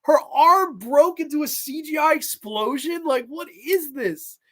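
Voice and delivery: a hint of mock outrage, up high; incredulous. Real, not acted. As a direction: hold the disbelief in a high voice. Mocking the CGI death scene.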